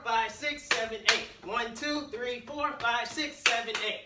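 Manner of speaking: loud rhythmic count while jumping; shouted counting. Real, not acted. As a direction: call each count out hard.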